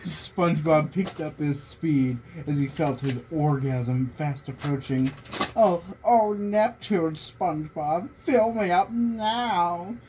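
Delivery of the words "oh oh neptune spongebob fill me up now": mild mock scream. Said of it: "Squidward screamed".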